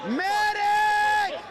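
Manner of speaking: high, loud desperate call for help; man down shout. Real, not acted. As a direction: scream for a medic across the crowd. Calling for medic.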